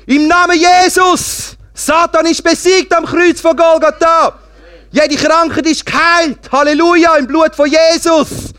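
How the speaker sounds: high, loud, drawn-out battle cry of prayer; shouted prayer. Acted. Preacher alone in his car prays against sickness, shouting at full force. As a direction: scream the declaration slowly at full force. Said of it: "habe nur angeschrien".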